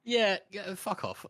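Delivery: dismissive brush-off. Retold or imitated. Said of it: "He's just like".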